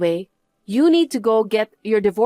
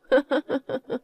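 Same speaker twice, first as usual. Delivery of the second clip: mocking laugh; 4 semitones higher, 3 dB quieter than usual. Wife laughs at cheating husband.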